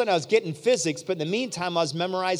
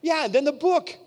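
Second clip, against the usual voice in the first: incredulous; 8 semitones higher than usual. Retold or imitated. Retold disbelief at the demand.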